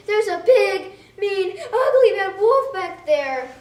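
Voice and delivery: high, loud, slow scared pig voice; little pig warning about the wolf, panicky and loud; frightened pig. Acted.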